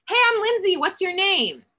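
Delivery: high bright call through the car window; cheery greeting. Retold or imitated. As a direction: call out warmly to get her attention. Retelling greeting a stranger.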